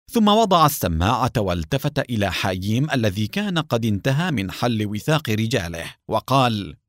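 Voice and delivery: usual speaking voice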